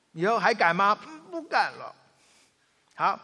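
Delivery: mother asks, daughter promises, acted; mother and child. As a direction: act both sides of the scolding. Mother questions, child answers.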